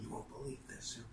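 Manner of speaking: put-on a touch of an older lady's hushed gossip. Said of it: "She's like".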